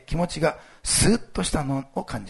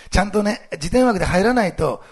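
normal talking voice

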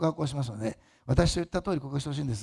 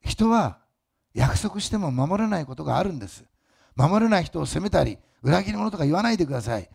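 loud, driving preacher voice; fervent preaching; 3 semitones higher, 6 dB louder than usual. Acted. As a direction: press it on the congregation. Preaching at full force.

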